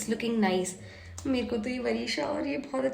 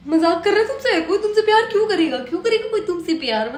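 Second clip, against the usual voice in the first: playfully taunting sibling, raised pitch; mocking sibling; 6 semitones higher, 5 dB louder than usual. Mocking her brother the way she teases at home. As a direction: jeer at a brother with a laugh. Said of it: "मैं same चीज कहती हूँ".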